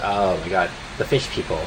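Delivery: usual speaking voice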